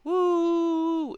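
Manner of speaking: high held mock party whoop; a hammed-up raver's woo. Narrator mocking party people, high drawn-out woo. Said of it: "I'm not really, like, a".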